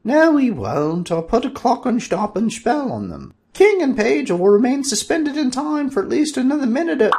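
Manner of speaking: high, loud sorceress voice casting a spell; Valerina's voice. Acted. Valerina halts king and page, high commanding sorceress voice. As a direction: freeze them in place with a high, commanding voice.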